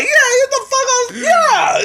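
high yelled mob agreement; imitated crowd yell; crowd joining in. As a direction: echo the heckle like a riled crowd. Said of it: "everyone's like".

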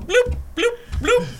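very high cartoon popping sounds; cartoon bloops. The dancing frog's kicks, high cartoon bloops. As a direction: pop out silly bloop noises. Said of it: "kicking, go bloop".

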